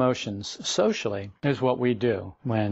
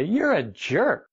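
blurted insult; 6 semitones higher, much slower than usual. Pretend. Acted blurting insult to boss.

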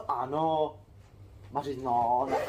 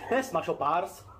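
ordinary talk

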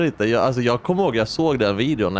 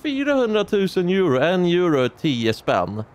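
mimicking her; 7 semitones higher than usual. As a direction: act out the confused calculation in a much higher voice. (hånfull imitation av politikern, ljus och långsam)

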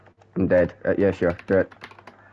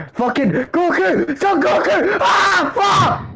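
yelling at the game; 14 semitones higher, slower than usual. Real, not acted.

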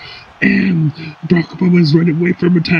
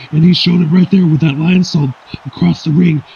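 normal talking voice

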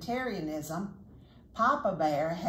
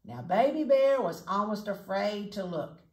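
ordinary delivery, nothing marked

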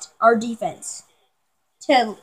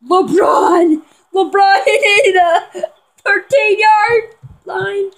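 higher, laughing shout of joy; excited cheer; 6 semitones higher, 3 dB louder, much slower than usual. Real, not acted. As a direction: cheer the play as it happens. Cheering a big play.

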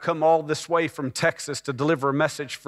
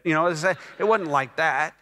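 normal talking voice

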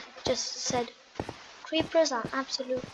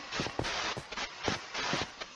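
soft gasp; 9 dB quieter than usual. Real, not acted. Spots ore while mining.

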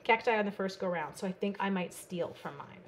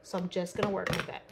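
ordinary talk

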